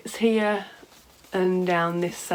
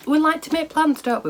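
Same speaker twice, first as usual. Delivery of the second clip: wry amusement; 10 semitones higher, 5 dB louder than usual. Real, not acted. Wry aside about plans.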